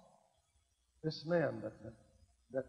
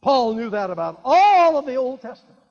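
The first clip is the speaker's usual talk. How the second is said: emphatic preaching; 6 semitones higher, 12 dB louder than usual. Real, not acted. Preaching burst.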